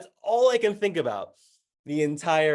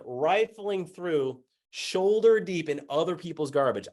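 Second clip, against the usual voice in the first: normal talking voice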